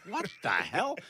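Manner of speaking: stunned disbelief. Retold or imitated. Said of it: "I was stunned".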